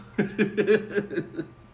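high, bright laugh at his own joke; real burst of laughter. Real, not acted. Pastor laughing at his sleepy wife, a real laugh. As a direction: laugh out loud at the thought.

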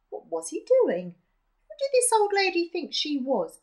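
ordinary delivery

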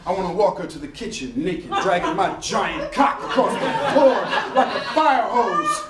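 normal talking voice

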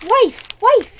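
loud, high desperate cry. Acted. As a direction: call out to his wife in panic. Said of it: "he cried".

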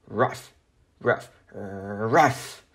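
dog barks "Rough" one after another; barking. Acted. Arfie barking about his rough days. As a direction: bark each word like a sad little dog.

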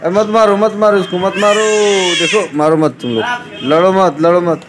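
calling out to stop a fight. Real, not acted. Uncle to the quarrelling little girls, loud urgent calling.

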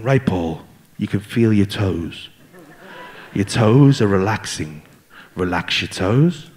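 very deep, slow, drawn-out guru voice; breathwork guide. Retold or imitated. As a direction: lull him into relaxing, low and slow. Guide leading breathing relaxation.

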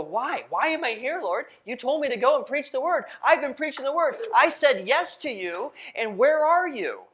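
a desperate prisoner calling on an absent God; anguished crying out. Acted. Paul crying out to God in the storm, anguished and loud. Paul's anguished prayer on ship.

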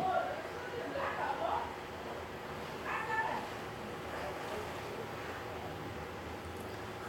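silent wheezing laugh, high and airless; long breathless laugh. Real, not acted. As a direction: laugh until no sound comes. Laughing at pooping story.

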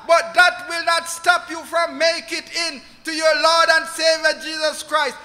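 normal talking voice

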